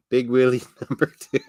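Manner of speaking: breaking into laughter mid-phrase; real giggle through the words; laughing. Real, not acted. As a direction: crack up at your own silly nickname. Cracks up at own nickname.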